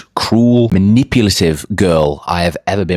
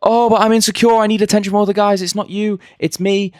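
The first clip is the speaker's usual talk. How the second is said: much higher, whiny mock girlfriend voice, faster in the middle; mocking girlfriend's excuses. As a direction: parody her fake insecure pleading. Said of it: "and the lies came".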